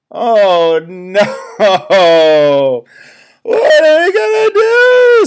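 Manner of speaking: very high, loud frantic cry; panicked animals. Acted. Animals crying out as the bear tower falls. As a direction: shriek it out in alarm. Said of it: "said all the forest animals".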